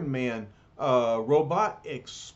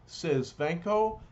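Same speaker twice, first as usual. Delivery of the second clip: ordinary delivery, nothing marked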